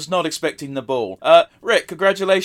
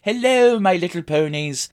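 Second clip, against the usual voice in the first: hammed-up feminine princess voice, higher opening; Princess Celestia; 4 semitones higher than usual. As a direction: greet your subjects in a badly faked royal lady's voice. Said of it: "a Princess Celestia voice".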